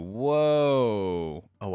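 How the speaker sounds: normal talking voice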